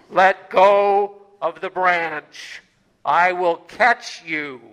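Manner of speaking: God calmly instructs the dangling hiker; God's voice. Acted. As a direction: speak slowly like God from the heavens. God's voice answers from above.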